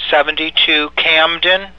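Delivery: normal talking voice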